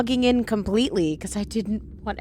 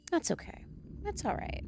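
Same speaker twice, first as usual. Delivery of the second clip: much higher, softer hushed reassurance; soft soothing whisper; 8 semitones higher, 14 dB quieter than usual. Real, not acted. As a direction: hush the dog gently. Soothing the dog softly.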